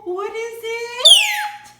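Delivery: loud, drawn-out slow what is it; really thrilled, stretched out; excited gasp-question. Real, not acted. Owner unveils the toy for her parrot, loud and thrilled.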